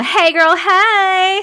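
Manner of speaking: high, loud sing-out greeting; loud playful call. Pretend. Streamer reading a viewer's greeting, called out high. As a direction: call it out big and rising. Calling out a greeting being read.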